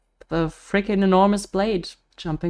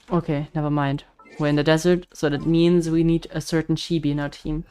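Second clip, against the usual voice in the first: ordinary talk, nothing marked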